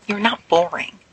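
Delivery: quick, sharp retort; cutting him off with a snapped reply. A narrator's reading. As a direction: snap back defensively. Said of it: "Sirius snaps".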